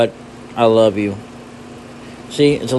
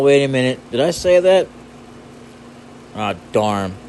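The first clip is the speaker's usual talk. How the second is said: normal talking voice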